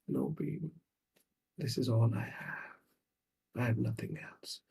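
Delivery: very soft, defeated mother; hushed, resigned reply; acted sad resignation. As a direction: quietly plead helplessness to a child. Mother resignedly answering her daughter.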